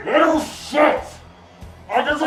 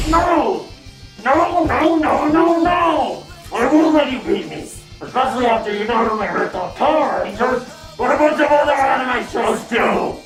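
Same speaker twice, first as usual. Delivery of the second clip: panicked villain. Acted. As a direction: cry out in desperate denial. Villain panics before defeat.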